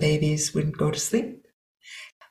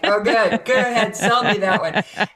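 sceptical sarcasm; 5 semitones higher than usual. Retold or imitated. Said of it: "They're like".

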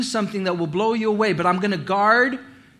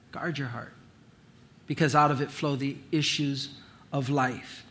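much softer, almost breathed; near whisper; 5 semitones lower, 8 dB quieter than usual. Acted. Preacher voicing God's repeated warning, fading to a hush. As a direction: let it fall away to barely a whisper.

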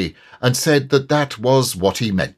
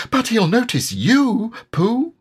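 small high timid piglet voice; tiny nervous animal voice; Piglet's small voice; 13 semitones higher than usual. A narrator's reading.

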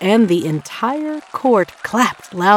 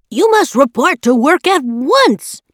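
high, louder commanding order; an employer barking orders at the door; 6 semitones higher, 4 dB louder than usual. A narrator's reading. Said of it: "the dressmaker ordered".

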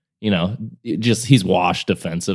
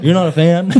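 high, louder retort breaking into laughter; laughing retort; 11 semitones higher, 5 dB louder than usual. Retold or imitated. Telling the hater off, high and laughing. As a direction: laugh through the jab. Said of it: "It's like".

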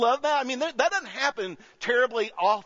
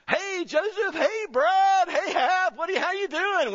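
much higher, bright sing-song hello from a hospital bed; cheery Lacey greeting; 13 semitones higher than usual. Retold or imitated. Pastor voicing Lacey greeting her visitors, high and cheery. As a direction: pitch it way up like a patient thrilled to see friends.

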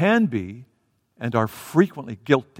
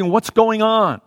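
raised, urgent asking; insistent questioning; 7 semitones higher, 5 dB louder, slower than usual. Acted. Blind beggar asking whoever sits near him, insistent questioning. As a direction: demand to know, pitched up. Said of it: "he just continued asking".

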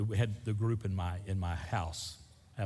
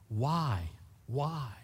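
ordinary talk

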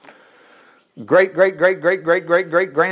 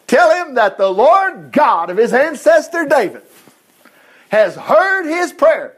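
louder and higher, ringing out; proclaiming the Lord's words; 7 semitones higher, 4 dB louder, slower than usual. Retold or imitated. Pastor voices the Lord to the class, proclaiming loud. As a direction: announce it like a divine decree.

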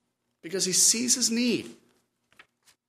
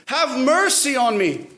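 high, raised plea for mercy; crying out; 6 semitones higher than usual. Acted. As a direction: call out like a beggar desperate to be heard. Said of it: "he began to cry out and say".

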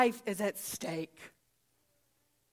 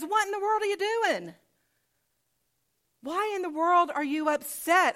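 high-pitched, incredulous questioning; exasperated challenge; 6 semitones higher than usual. Acted. Jesus confronts angry Pharisees, acted.

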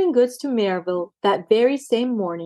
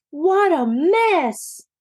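dismayed cry; 7 semitones higher, much slower than usual. A narrator's reading. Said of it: "cried Daisy".

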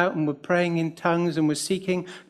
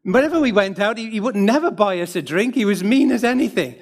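raised, louder mock-indignant voice; mild mock complaint, higher and louder; quoting a blunt friend; 4 semitones higher, 5 dB louder than usual. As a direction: imitate the friend griping about the brother. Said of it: "a friend said".

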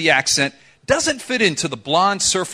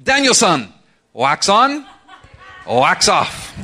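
imitated Japanese-accented English, raised and punchy; Miyagi's distinct accent; 4 dB louder, slower than usual. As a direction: play the old karate teacher's Japanese accent. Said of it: "in a very distinct accent".

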